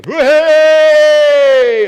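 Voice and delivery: put-on high, very loud kid's hooray; imitating a daughter's shrieked hooray; child's cheer. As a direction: cheer like an overexcited little girl. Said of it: "as my daughter would say".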